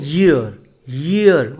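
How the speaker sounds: ईयर with a strong initial y, "yeer"; pronunciation demo. Shown as a demonstration. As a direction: lean on the y glide opening ईयर. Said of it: "इसको पढ़ते हैं ईयर". (ईयर के शुरू में 'य' की आवाज़)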